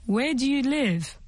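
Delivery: normal talking voice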